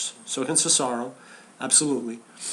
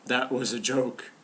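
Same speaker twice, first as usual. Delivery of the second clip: imitating pissed-off deadpan; 3 dB quieter than usual. Host quoting Ryback after his joke fell flat, mock pissed-off. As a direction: retell it slowly, as if sorely unamused. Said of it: "He's just so pissed off".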